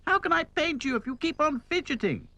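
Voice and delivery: very high-pitched scolding doll; exasperated, high character voice; calling out crossly. A narrator's reading. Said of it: "she called".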